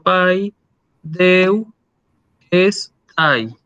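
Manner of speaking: paidewestai broken into pai, deu, es, tai with clear pauses; syllable by syllable. Shown as a demonstration. Teacher separates the word into syllables for students, syllable by syllable. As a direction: split the word into separate syllables, pausing after each. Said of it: "Si yo separo en sílaba esta palabra".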